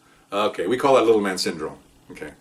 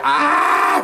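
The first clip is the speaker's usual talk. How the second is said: long, high mimicked cry; imitated scream; 3 dB louder than usual. Guy just got shot with taser, imitated scream. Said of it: "from the guy that just got shot".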